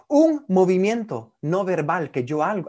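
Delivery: ordinary delivery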